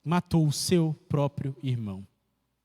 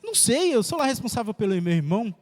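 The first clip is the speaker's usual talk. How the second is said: sharply higher "Não sei", then a scornful rising "lá"; Cain's insolent retort with a scornful lift on "lá"; petulant answer; 4 semitones higher than usual. Retold or imitated. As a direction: sneer it back like an insolent brat. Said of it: "resposta tão petulante de Caim".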